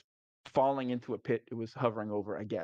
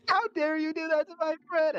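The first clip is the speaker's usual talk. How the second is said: much higher and louder, mock indignant; outraged high voice; 5 dB louder than usual. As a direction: squeal it like an offended hero. Character avenging fallen friend.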